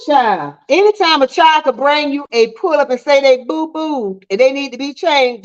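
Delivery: ordinary talk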